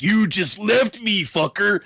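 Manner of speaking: drawn-out betrayed Savage; Macho Man bitter. Retold or imitated. Savage glares at the entrance, mock bitter. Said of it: "be like".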